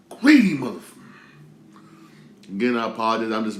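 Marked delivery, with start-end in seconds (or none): 0.1-0.8 louder, spitting the words out in disgust; angry outburst. Real, not acted. Ranting about greedy people at the morgue, real angry shout. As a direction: let the anger burst out in a loud curse.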